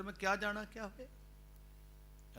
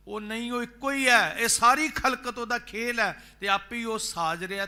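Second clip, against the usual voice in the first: higher, louder proclaiming; fervent preaching; 8 semitones higher, 12 dB louder than usual. Acted. Preacher proclaims to the sangat, loud and raised. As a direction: proclaim it with full force.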